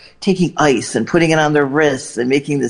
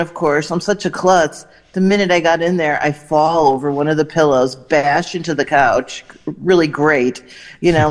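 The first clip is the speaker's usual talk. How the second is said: ordinary talk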